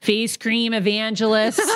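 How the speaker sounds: slow Boston drawl on evangelists; Boston accent. Shown as a demonstration. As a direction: lean into the Boston vowels. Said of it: "You gotta do the eh".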